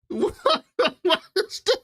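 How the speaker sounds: high, giggling question; cracking up in disbelief; laughing disbelief. Real, not acted. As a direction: laugh through the words in disbelief. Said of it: "laughing disbelief".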